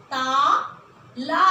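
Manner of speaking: ताला stretched slowly with a rise; slow blended word. Shown as a demonstration. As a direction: draw out ताला slowly for the child. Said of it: "अब देखिए ये क्या बना". (बच्चों के लिए ताला धीरे खींचकर)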